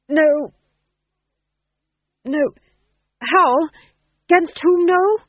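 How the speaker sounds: high, loud declamation breaking down; actor drying on his line. A narrator's reading. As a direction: grope loudly for the forgotten line.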